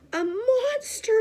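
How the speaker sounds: high, loud, slow scream of fright; terrified shout. A narrator's reading. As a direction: shriek out the alarm. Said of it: "shouted Baby".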